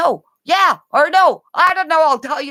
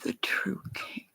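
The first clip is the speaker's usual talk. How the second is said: whisper; 4 semitones lower, 16 dB quieter than usual. Real, not acted. Whispered opening to camera.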